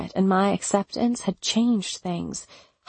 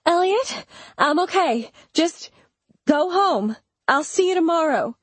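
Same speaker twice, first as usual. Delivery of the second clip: frightened pleading; 10 semitones higher than usual. Acted. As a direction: fake calm over panic, begging him off. Pleading through the door, afraid.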